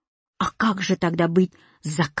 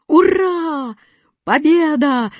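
high, drawn-out triumphant cheer; joyful; 8 semitones higher, 4 dB louder, slower than usual. A narrator's reading. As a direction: cheer in jubilation. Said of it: "радостно прыгал восклицательный". (радостное ликование)

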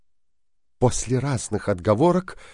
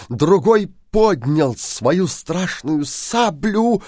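ordinary delivery, nothing marked

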